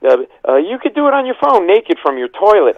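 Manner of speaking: high, loud flustered babble; hammed-up flustered gushing; nervous babble. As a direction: gush nervously to a hero. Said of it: "I actually said to [name]".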